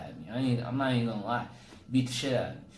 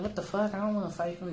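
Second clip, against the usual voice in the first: scared protest; 9 semitones higher, faster than usual. Retold or imitated. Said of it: "I was like".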